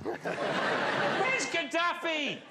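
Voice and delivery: high, drawn-out mock dog call. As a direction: stretch it out as if calling a lost pet. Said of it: "that's the name of your dog".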